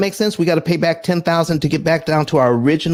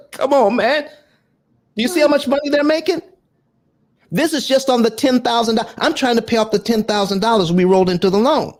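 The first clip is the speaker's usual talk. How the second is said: exasperated; 9 semitones higher than usual. Real, not acted. Outraged at bank's interest.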